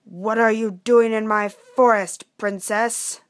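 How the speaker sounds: loud, challenging dragon voice. Acted. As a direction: confront an intruder as the dragon. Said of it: "the dragon asked".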